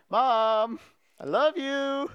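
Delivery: high, drawn-out call to mom; pleading singsong call. Retold or imitated. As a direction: coax her in a high singsong, re-enacted. Imitates himself pleading with mom.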